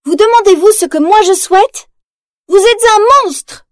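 high, loud furious outburst; angry yell. Acted. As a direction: scream it at your mother. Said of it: "hurle Mérida".

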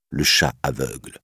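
ordinary delivery, nothing marked

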